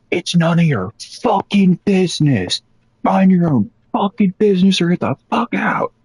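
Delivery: high-pitched mock screaming at the visitor; retold screaming. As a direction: shriek it like the furious manager. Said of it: "she was screaming at the employees".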